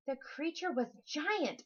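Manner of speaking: usual speaking voice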